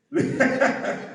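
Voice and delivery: a quick amused chuckle; short chuckle. Real, not acted. Preacher joking about getting them, short chuckle. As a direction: chuckle at your own threat. Preacher laughs at his own joke.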